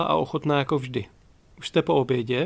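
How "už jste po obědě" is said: ordinary delivery, nothing marked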